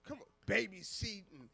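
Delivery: ordinary delivery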